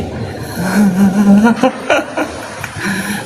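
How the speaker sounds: long, high-pitched giggle; giggling at the guard's excuse; high laugh. Real, not acted.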